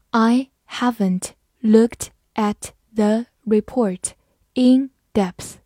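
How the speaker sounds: word by word, evenly spaced pauses; slow read-along. Shown as a demonstration. Teacher leads students through the example, slow read-along. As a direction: read slowly, separating each word. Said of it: "跟我慢读一遍".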